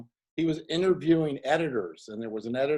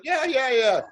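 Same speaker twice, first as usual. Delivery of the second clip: high, loud breezy yeah-yeah; hammed-up eager director, pitched up and loud; upbeat director; 5 semitones higher, 5 dB louder than usual. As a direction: brush off the worry cheerfully. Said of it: "He goes".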